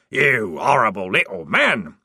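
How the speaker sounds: loud furious scream at the troll. Acted. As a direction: yell it with rage. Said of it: "he screamed".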